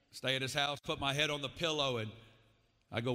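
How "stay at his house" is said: ordinary talk, nothing marked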